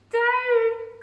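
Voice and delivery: high loud cry of down; a witch shouting her broom down. Acted. Witch yelling at her broom to land, high and loud. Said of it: "cried the witch".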